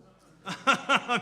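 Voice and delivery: laugh. Real, not acted. Telling the crowd he forgot a lover, laughing. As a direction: crack up at your own punchline. Laughs at his own punchline.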